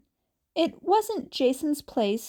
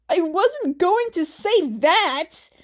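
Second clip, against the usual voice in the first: indignant protest; 6 semitones higher, 5 dB louder than usual. Acted.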